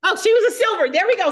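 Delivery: loud, high burst of delight; excited sudden recall. Real, not acted. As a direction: exclaim it as the memory comes back. Suddenly remembers what she forgot.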